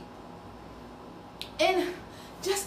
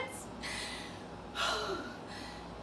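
long breathy sigh; 8 semitones higher, 10 dB quieter than usual. Acted. Dreamy sigh.

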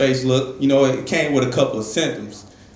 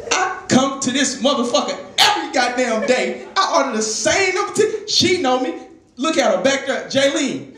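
high-pitched, hammed-up indignant rant; outraged customer; 10 semitones higher than usual. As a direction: complain like a betrayed regular, pitched way up. Acts out a customer denied nuggets.

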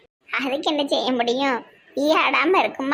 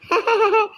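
short, high laugh; 7 semitones higher than usual. Acted. Woman amused by all the garlands, high laugh. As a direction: let out a short, high chuckle.

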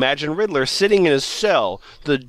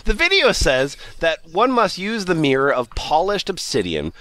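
normal talking voice

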